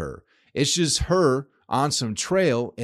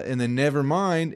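normal talking voice

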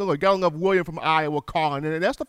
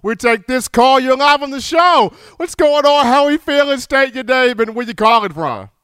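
radio host call intro; 6 semitones higher, 7 dB louder than usual. Acted. Host takes a new caller.

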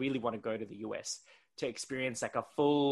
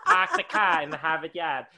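loud, laughing mock Boston accent; badly faked Boston, flattened "Harvard", falling at "yard"; 4 semitones higher, 8 dB louder than usual. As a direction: ham up a Boston accent, dropping the R in "car" and "yard". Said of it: "that's a really bad Boston accent".

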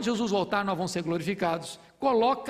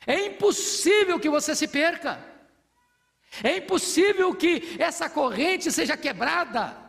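higher, louder fervent proclamation; triumphant sermon climax, higher and louder; preaching at full force; 7 semitones higher, 3 dB louder than usual. Acted. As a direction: proclaim it with rising fire.

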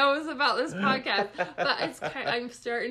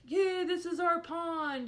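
higher, drawn-out mock cheer; excited kids imitation; 6 semitones higher, slower than usual. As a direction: voice kids claiming their pond. Retold kids jumping into pond.

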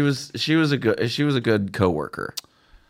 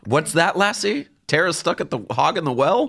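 high, questioning mock concern; hammed-up rescue-scene questioning, pitched up; Lassie's owner; 7 semitones higher than usual. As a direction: quiz the dog as if decoding its barks. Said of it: "boy that Lassie is saving".

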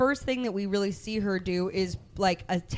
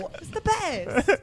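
slow, higher sarcastic quote; slow high mock reply; 10 semitones higher, slower than usual. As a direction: drag it out, high and fake. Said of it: "quoting Topaz".

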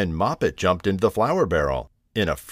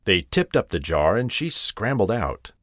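plain reading voice

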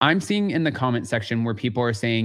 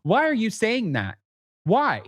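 mock accusing; 10 semitones higher than usual. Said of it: "she's like".

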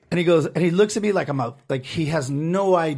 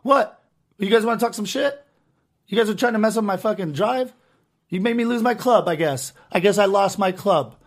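mock innocent; 7 semitones higher, 4 dB louder than usual. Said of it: "I'm like".

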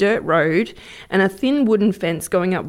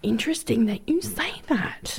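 whisper; 8 dB quieter than usual. Acted. Spooky reply to Blair Witch.

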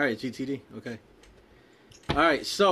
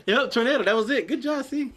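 laughing; 9 semitones higher, 3 dB louder than usual. Real, not acted. Laughing after the right answer.

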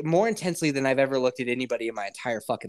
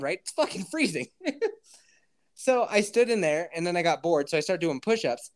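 ordinary talk

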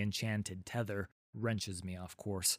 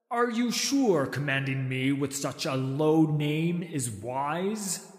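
higher, drawn-out, haughty question rising at the end; taunting purr; 5 semitones higher, 7 dB louder than usual. A narrator's reading. Dragon challenging the warrior who commands it, taunting purr. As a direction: purr it as a veiled threat. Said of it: "I purr".

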